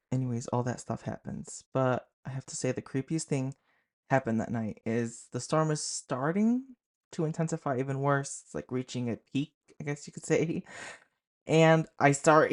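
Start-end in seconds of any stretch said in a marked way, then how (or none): none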